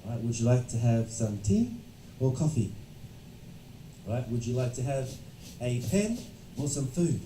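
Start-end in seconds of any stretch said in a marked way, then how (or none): 0.2-2.7 "tea" rising, "coffee" falling; up-and-down intonation. Shown as a demonstration. Teacher modelling a choice question, up-and-down intonation. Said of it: "voice needs to be going up and down". 4.3-7.2 "pen" rising, "food" falling; up-and-down intonation. Shown as a demonstration. Teacher modelling a choice question, up-and-down intonation. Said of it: "make your voice go up and down".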